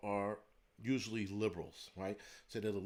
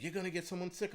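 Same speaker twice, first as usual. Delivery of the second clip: higher-pitched mock scolding; imitated scolding lady; 8 semitones higher than usual. Lady scolding him over his mask, mocking imitation. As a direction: lightly voice a nagging shopper. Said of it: "She's like".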